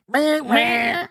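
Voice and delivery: high-pitched squeezed rubber chicken squeaks; squeezed-toy bleat, very high; squeaky chicken toy. Retold or imitated. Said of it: "those little squeezy chickens ... they go".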